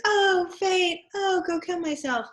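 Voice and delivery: high, melodramatic mock lament; Jocasta. Lecturer mocking a woman's expected role, hammed-up lament. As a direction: bemoan fate in a hammed-up high voice. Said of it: "Jocasta doesn't really, you know".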